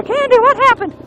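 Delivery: a mom rushing over in alarm. Retold or imitated. Candy's mom asking her daughter, worried mother voice. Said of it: "she sounds just like my mom".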